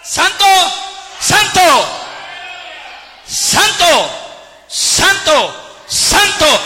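rising shouted praise that softens; calling out. Retold or imitated. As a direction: cry out holy like the angels. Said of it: "dando voces diciendo".

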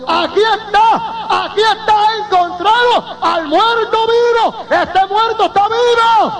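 high, drawn-out cry of discovery; retold shouting. Doctor finds the living man among the dead, high astonished shouting. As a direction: shout it out high, stretching the words. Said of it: "empezó a gritar y dijo".

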